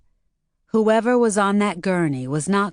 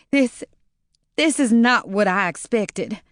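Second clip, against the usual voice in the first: a stammered "This, this" start; a slight stammer; 4 semitones higher than usual. Acted. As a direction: trip over the first word. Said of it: "Maureen stammered".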